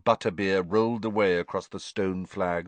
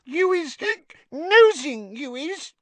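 high, tipsy house elf; a wobbly, squeaky little elf; 6 dB louder, slower than usual. A narrator's reading. Drunk Winky accusing Harry.

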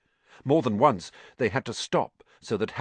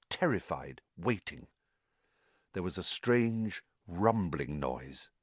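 plain reading voice

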